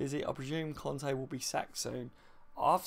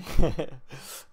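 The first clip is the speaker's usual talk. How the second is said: laugh; 13 dB louder than usual. Real, not acted. Laughing at own remark.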